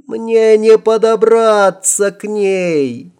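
high, slow pained ghost cry; moaning ghost voice. Acted. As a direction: wail it like a hurting spirit. Простонала на ментальном уровне Адель.

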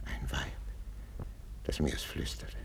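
very soft, half whispered; hushed brooding. Acted. King brooding to himself over the accusation, hushed. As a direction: sink into a near whisper.

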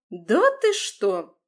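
slow, loud fake amazement; mock admiration. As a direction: pretend to be impressed. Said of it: "наигранно восхитилась Рада".

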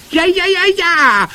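very high, loud wailing witch; howl. A narrator's reading. First witch insists she smells it, howling. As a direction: howl each word at the top of the voice. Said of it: "heulte die erste Hexe".

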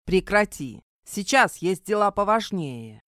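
plain reading voice

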